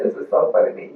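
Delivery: soft, quick murmur to oneself; quietly amused thought, barely voiced; inner thought. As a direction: drop to a hushed, fast aside. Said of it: "like, yelling, but I'm like".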